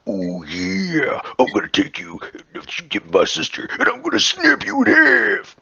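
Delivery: loud wrestler promo roar; hammed-up Slim Jim wrestler; Macho Man voice. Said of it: "ready to snap into a Slim Jim".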